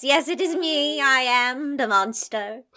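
booming pharaoh. Pretend. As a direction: announce yourself like an ancient ruler. Otter speaks as Loch Ness monster.

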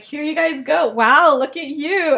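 mock fawning, drawn-out wow; gushing matchmaker. [name] hands his friends drinks, mock gushing.

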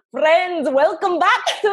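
put-on giggly, half-hearted Scottish accent; a hint of Scottish accent, breaking into laughs; Scottish accent attempt. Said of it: "intro this one with the Scottish accent".

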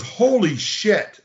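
Jim's shocked reaction. Retold or imitated. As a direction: stretch "Holy shit" up high and slow in disbelief. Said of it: "He goes".

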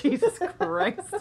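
slow, high, exasperated Jesus Christ; slow exasperated groan. Real, not acted. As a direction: drag it out in mock despair. Reacting to gross joke.

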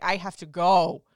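loud, drawn-out insistence; pained, emphatic declaration; urgent retold plea. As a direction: stretch it out loudly, needing to leave. Retold urgent exit during contractions.

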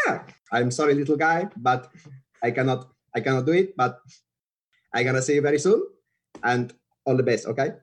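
Rafa impression. Rafa turning down the fan to a film crew, light Spanish accent. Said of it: "try not to do Rafa".

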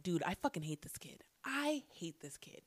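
fast unvoiced whisper, seething; whispered grudge from the ground; whispered curse. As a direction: hiss it under your breath. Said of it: "I'm like".

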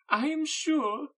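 very high, loud and drawn out; gushing Mrs Bennet. Acted. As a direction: gush over the hosts as a fawning mother.

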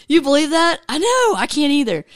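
usual speaking voice

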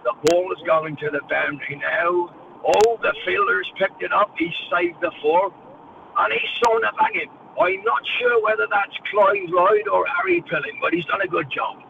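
put-on measured old-style commentator, lifting as the play unfolds; John Arlott. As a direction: mimic an iconic English cricket commentator in accent. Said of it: "And John Alec goes".

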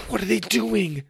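high-pitched rising alarm; starting to panic. Acted. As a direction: say it as panic begins to set in. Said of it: "starting to panic".

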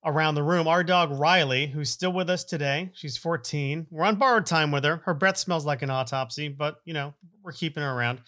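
ordinary talk

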